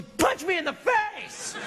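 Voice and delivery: high, drawn-out strained cry; slow it down and wail it high; hammed-up shout of disbelief; comic outburst. Acting out being punched by girlfriend.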